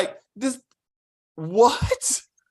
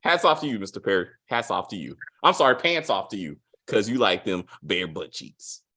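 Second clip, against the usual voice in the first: sarcastic tribute; 9 semitones lower than usual. Retold or imitated.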